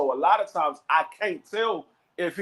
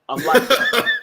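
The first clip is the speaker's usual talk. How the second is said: tough boast; 6 semitones higher, 6 dB louder, slower than usual. Retold or imitated. Acting a boxer's challenge.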